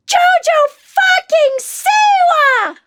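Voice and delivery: put-on very high, drawn-out little-kid squeal of a shout; shouting toddler. Toddler spotting [name] in Starbucks, high shrieking shout. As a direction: shriek it slowly like an excited three-year-old. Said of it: "the two-year-old or three-year-old goes".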